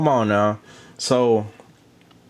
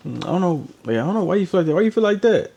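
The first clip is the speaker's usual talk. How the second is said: rising, higher mocking voice of a clueless boyfriend; 8 semitones higher than usual. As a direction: play him dismissive and puzzled, climbing up. Mocking KC's dismissive reply.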